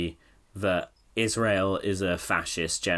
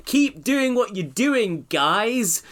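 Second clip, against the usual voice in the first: mock smug Brit; 12 semitones higher, 4 dB louder, slower than usual. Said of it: "and saying".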